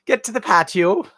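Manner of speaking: a bad stage-Irish lilt, rising at the end; bad Irish accent. Shown as a demonstration. Vlogger botching an accent on the patio plug, bad Irish. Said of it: "that sounded like bad Irish".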